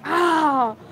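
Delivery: short high "ah" of a burnt hand; quick startled yelp; retold pained yelp. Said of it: "He screams".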